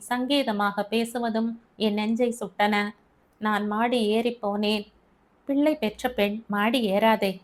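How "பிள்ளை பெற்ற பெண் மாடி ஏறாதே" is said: usual speaking voice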